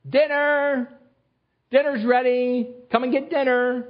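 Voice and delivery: high, ringing call to dinner; yelling across the room. Retold or imitated. Father calling children to dinner.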